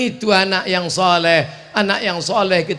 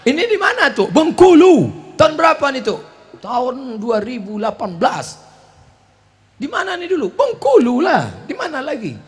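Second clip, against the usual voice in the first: put-on higher curious child questions; lightly voiced grandchildren; 7 semitones higher, 4 dB louder than usual. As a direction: play the grandkid asking eagerly. Grandchildren asking about photo.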